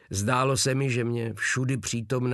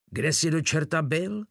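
higher-pitched reproachful question; mild reproach; 6 semitones higher than usual. A narrator's reading. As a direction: ask it as a mild scolding. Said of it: "s výčitkou v hlase". (mírně vyčítavě)

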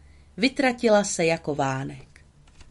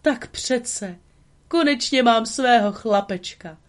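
high, happy and loud; joyful booming; 5 semitones higher, 4 dB louder than usual. Acted. Geppetto rejoicing over his new son, high and joyful. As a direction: rejoice over your new little boy. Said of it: "hlaholil a vesele skotačil".

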